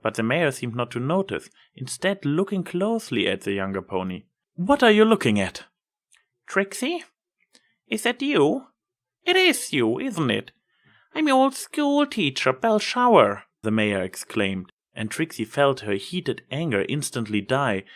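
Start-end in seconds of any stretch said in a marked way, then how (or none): 4.5-5.6 annoyed snap. Acted. Trixie snaps at staring mare. 6.5-13.4 put-on much higher, wondering elderly mare; an old teacher amazed to see a former pupil; surprised old teacher. Old teacher recognizes Trixie.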